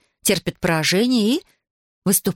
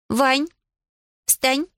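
higher-pitched order, word by word; commanding; 6 semitones higher than usual. A narrator's reading. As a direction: command him with a pause between words. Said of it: "скомандовала Аделаида". (командным тоном, раздельно)

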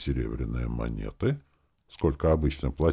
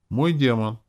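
plain reading voice

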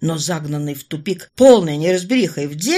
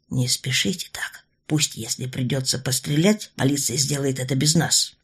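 a wary gentleman urging caution; cautious older man; 6 semitones lower than usual. Acted. Stern restrains Orlova.

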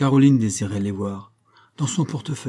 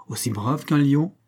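ordinary delivery

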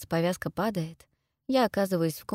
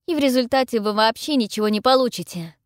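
raised, assured, forceful statement; firm, higher, projecting defiance; loud and confident; 5 semitones higher, 7 dB louder than usual. A narrator's reading. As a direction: state it loudly with full confidence. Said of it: "говорю я громко и уверенно".

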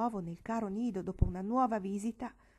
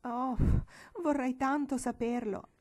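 desperate voice; 5 semitones higher, 4 dB louder than usual. Acted. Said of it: "con voce disperata soggiunse".

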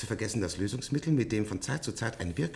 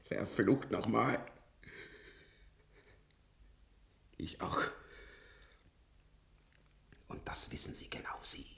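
bitter whisper; 3 semitones lower, 10 dB quieter than usual. Acted. Old patient accuses the staff in a bitter whisper. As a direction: curse it, then let the voice die away.

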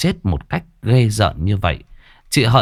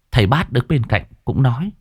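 usual speaking voice

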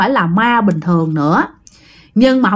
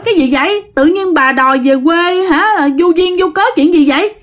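high, quick, puzzled questions; husband asking his wife what's going on; baffled husband; 8 semitones higher than usual. Retold or imitated. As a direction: fire off confused questions. Dad baffled at sudden demand.

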